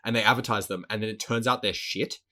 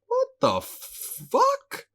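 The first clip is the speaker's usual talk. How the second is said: slow, high, drawn-out disbelief; stunned disbelief; much slower than usual. Retold or imitated. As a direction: stretch it out, stunned. Inner reaction quoted, disbelief.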